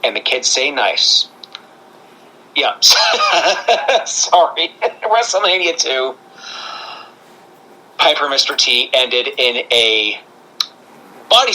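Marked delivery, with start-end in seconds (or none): 2.8-6.1 real laugh breaking into the words. Real, not acted. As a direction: try to carry on through the giggles, higher-pitched. Cracks up at own joke.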